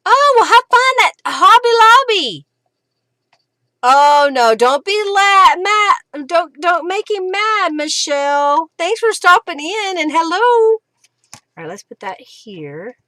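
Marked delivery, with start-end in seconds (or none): 0.1-10.8 excited high chatter. Real, not acted. Loud chatter to visitor.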